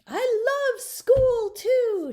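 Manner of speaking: very high, loud yell, near crying; girl yelling love to her teacher. A narrator's reading. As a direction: call it after the teacher. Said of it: "she added".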